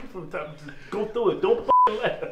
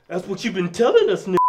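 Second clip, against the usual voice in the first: ordinary talk, nothing marked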